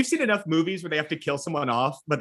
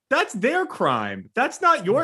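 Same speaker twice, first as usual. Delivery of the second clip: indignant; 8 semitones higher, much slower than usual. Retold or imitated. Retold indignant reply.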